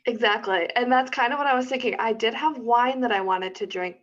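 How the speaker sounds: ordinary talk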